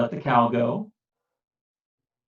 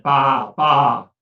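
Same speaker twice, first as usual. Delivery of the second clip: sheep bleat; 5 dB louder than usual. A narrator's reading. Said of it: "The sheep said".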